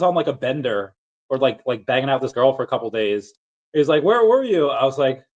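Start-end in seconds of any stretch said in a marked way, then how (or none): 4.0-4.7 high, louder where were you; surprised friend. Retold or imitated. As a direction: demand to know, surprised. Roommate Mike asks.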